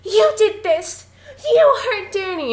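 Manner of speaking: high, loud tearful accusation; Wendy. Retold or imitated. Podcaster acts out mother accusing her husband, high wailing accusation. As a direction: imitate a distraught mother accusing, slow and high. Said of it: "she turns to Jack and she's like".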